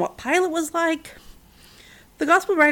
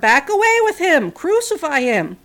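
higher and louder, slowing at the end; roaring crowd; 5 semitones higher, 5 dB louder than usual. A narrator's reading. Mob roaring at Pilate to crucify Jesus, loud roar. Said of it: "The crowd roared".

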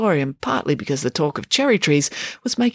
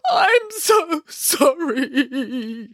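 put-on very slow, high, crying child; tearful little boy sobbing; sobbing apology; 12 semitones higher, much slower than usual. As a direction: sob out the apology. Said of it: "he sobbed".

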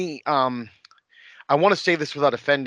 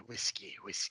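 whispered; 6 semitones lower, 17 dB quieter than usual. Real, not acted. Murmured echo of whiskey.

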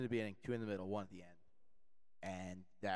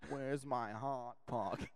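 put-on whiny man-child; 3 semitones higher, much slower than usual. Mimicking basement man-child.

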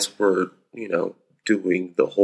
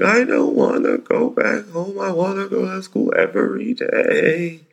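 imitating crying child; 12 semitones higher than usual. Dad mimics son crying that he wants school every day, high whine. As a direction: plead like a tearful four-year-old. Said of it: "It's like".

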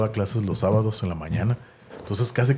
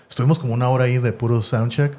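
usual speaking voice

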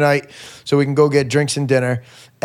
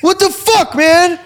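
shrill-high mock panic, louder; freaking out; 3 dB louder than usual. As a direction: squeal it like he just realised the hour. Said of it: "He's like".

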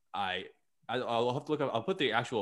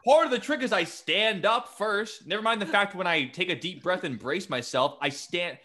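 indignant Houdini; 9 semitones higher, 5 dB louder than usual. Retold or imitated.

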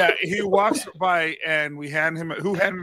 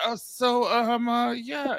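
awkward stalling; 9 semitones higher, much slower than usual. Retold or imitated. Woman fumbling for a way out of a chat, awkward stalling. Acts out her awkward exit.

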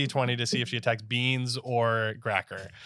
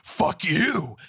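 angry retort; 5 semitones higher, 5 dB louder than usual. Acted. Gracker curses back.

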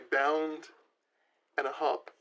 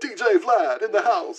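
high, loud DJ hype call; hype announcer; 8 semitones higher, 9 dB louder than usual. Acted. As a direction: shout it like a club MC. Hyping the DJ.

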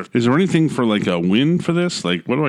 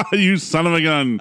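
laughing; 8 semitones higher than usual. Real, not acted. Laughing at being fooled.

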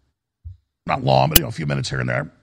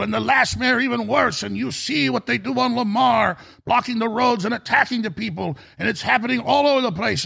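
worried shopkeeper; 8 semitones higher than usual. Retold or imitated. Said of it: "he says".

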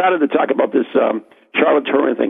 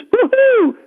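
excited cheer. Real, not acted. Cheering the quiz result.

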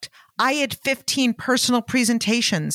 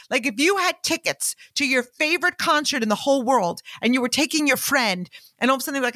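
ordinary talk

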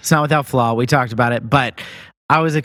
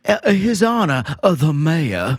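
hammed-up Southern drawl, raised pitch at first; Southern accent; 4 semitones higher than usual. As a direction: announce it in a mock Southern accent. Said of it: "we can finish 'em off that way".

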